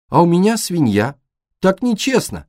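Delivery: put-on high, loud childish protest; aggrieved high-pitched outcry; indignant boy. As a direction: protest loudly that it is unfair. Boy protests his pudding charm.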